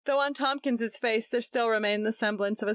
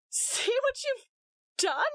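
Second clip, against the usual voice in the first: much higher tearful accusation; tearful sobbing reproach. A narrator's reading. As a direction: choke it out through tears. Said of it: "she sobbed".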